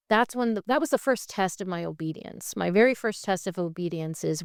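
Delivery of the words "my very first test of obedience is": ordinary talk, nothing marked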